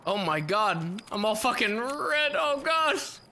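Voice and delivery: startled panic at the screen; alarmed. Real, not acted. Sees his character all red.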